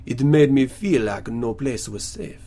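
slow, badly faked Mexican accent; terrible Mexican accent. Quoting Missus Gomez, terrible mock Mexican accent. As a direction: attempt a poor Mexican accent, slowly. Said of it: "that was a terrible accent".

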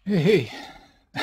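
out of breath. Real, not acted. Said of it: "Sorry, I'm out of breath".

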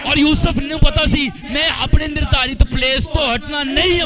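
loud, driving preacher's proclamation; full-force preaching. Real, not acted. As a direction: preach it out to the whole congregation.

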